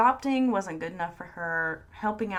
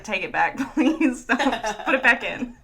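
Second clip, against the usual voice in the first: laughing plea; 3 semitones higher, 8 dB louder than usual. Pretend. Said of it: "Kevin would be like".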